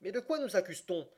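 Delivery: impatient cry. A narrator's reading. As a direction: exclaim with mild impatience. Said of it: "s'écria Passepartout, impatienté". (protestation impatientée, voix plus aiguë)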